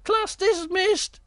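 very high, squeaky wailing bear; high-pitched mortified bear; embarrassed Little Bear. Acted. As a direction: squeak out your embarrassment, drawing out the groans. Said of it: "he was so embarrassed".

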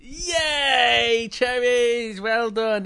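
high, loud, drawn-out yay; cheering praise. Real, not acted. Storyteller congratulates a child on the right answer, cheering.

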